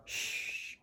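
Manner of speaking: unvoiced Shh with teeth showing; shh sound. Shown as a demonstration. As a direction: hiss Shh through the teeth. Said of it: "then start up with shh".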